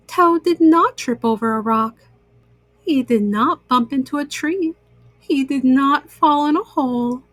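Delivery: ordinary delivery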